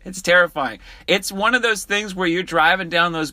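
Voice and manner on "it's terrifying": ordinary delivery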